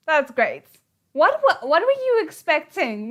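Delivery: high, loud yelled reaction; loud disbelieving yell; exasperated yell. Real, not acted. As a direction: shout at the screen. Reacting loudly to the scene.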